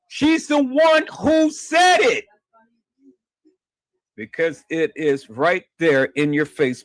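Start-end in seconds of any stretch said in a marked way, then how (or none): 0.1-2.3 high shouted insistence; said it shouted loud and high; shouting. Real, not acted. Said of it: "repeated louder".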